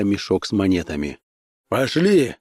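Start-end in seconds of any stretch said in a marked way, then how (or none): 1.7-2.4 short command, pitched higher; mildly barked command; barked order. A narrator's reading. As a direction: bark it as an urgent order. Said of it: "рявкнул он Диане".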